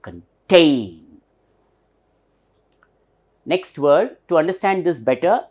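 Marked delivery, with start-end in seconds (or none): 0.0-1.2 "contain" with TAIN loud, long and falling; loud upper stress. Shown as a demonstration. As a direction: swallow "con", then hit "TAAIN" hard in "contain". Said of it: "we have to pronounce hardly or clearly, loudly".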